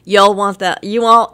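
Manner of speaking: a light, mock southern drawl on Y'all; playfully imitating a Southern shop clerk; southern drawl imitation. Imitating a Southern store clerk to a radio cohost, mock drawl. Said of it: "talk with a slight southern twang".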